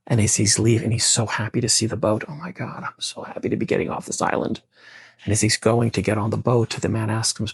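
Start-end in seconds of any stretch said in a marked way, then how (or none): 2.3-4.6 relieved hush. Acted. Relieved castaway at boat.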